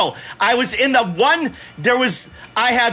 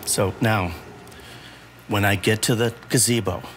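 forced patience; 7 dB quieter than usual. Real, not acted. Dad asking where to go from gazebo, forced patience. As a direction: hold back exasperation, low and deliberate.

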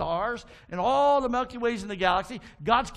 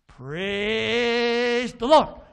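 very long, loud, rising squawk; talking bird; 3 semitones higher, 7 dB louder, much slower than usual. Acted. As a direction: stretch it out and blare it like a bird. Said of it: "this bird's great".

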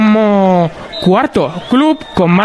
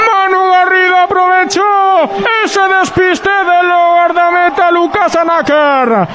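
breakneck goal commentary; 5 semitones higher than usual. Real, not acted. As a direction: shout the goal news, drawn out. Goal replay, live commentary.

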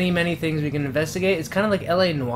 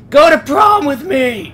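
high, very loud, drawn-out shout; full-lung scream; 12 semitones higher, 13 dB louder, slower than usual. Shown as a demonstration. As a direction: scream the prom invitation at the top of your lungs. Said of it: "while screaming at the top of your lungs".

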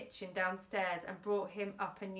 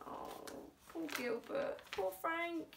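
soft, higher-pitched sympathetic aside; pitying aside; 8 semitones higher, 6 dB quieter than usual. Real, not acted. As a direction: sigh it softly with sympathy. Reader pities the characters.

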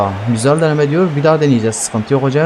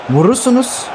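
excited call; 10 semitones higher than usual. Real, not acted. Urging teammates to shoot.

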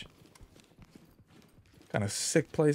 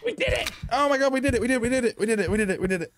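excited; 12 semitones higher, 4 dB louder, faster than usual. Real, not acted. Mission success celebration.

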